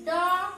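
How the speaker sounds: teacher demonstrating डॉ; sounding out a syllable. Shown as a demonstration. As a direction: round the mouth for डॉ. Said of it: "मुंह कैसे होता है जब इसका साउंड आता है".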